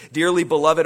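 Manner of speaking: ringing, forceful Dearly beloved. Acted. Sermon address called out.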